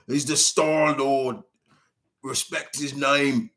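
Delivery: slow, lightly put-on Cockney accent; a hint of Cockney swagger, drawn out slowly; imitating Rocket's Cockney accent. Rocket demanding respect for Star-Lord, in a light mock Cockney accent. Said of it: "A, a strong Cockney accent".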